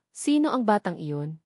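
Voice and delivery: usual speaking voice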